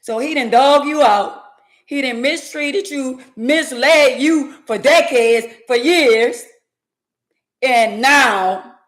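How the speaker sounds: rising sermon about a man who did her wrong; preachy build. Real, not acted. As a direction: preach it at her like a sister in church.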